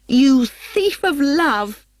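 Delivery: high, loud, slow accusation; jealous woman yelling at rival; acted fury. A narrator's reading. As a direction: spit the charge at her. Hermia's acted quarrel.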